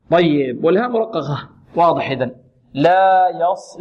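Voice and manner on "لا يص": لا يص drawn out, heavy ص; correct slow model. Shown as a demonstration. Tajweed teacher modelling لا يص slowly. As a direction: stretch لا and settle on the ص of يص. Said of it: "ننطقها هكذا".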